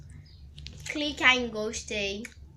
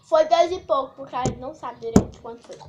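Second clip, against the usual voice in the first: loud; 2 semitones higher, 11 dB louder than usual. Real, not acted. Arguing over gum price.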